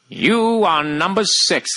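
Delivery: very slow, drawn-out pronouncement; mimicked old TV dialogue, drawn out; TV intro impression. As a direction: stretch it out like a TV villain.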